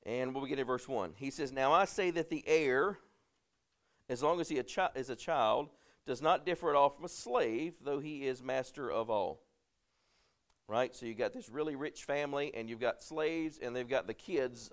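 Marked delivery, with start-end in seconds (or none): 1.5-9.4 scripture reading. Acted. Preacher reading scripture to the congregation, raised and measured. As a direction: read the verse out to the congregation. Reads scripture aloud in sermon.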